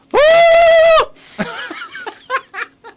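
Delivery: loud, high turkey gobble call; gobbling turkey impression. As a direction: let out a big squawking gobble. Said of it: "my turkey impression".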